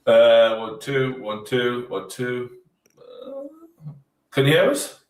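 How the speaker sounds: distant, roomy mic check; talking from across an echoey room. Real, not acted. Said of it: "echoey around the room".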